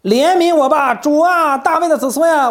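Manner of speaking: high, loud desperate cry for mercy; loud plea. Acted. Canaanite mother crying out to Jesus, loud desperate plea. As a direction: cry out to the Lord, begging and loud. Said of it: "她大声喊着说".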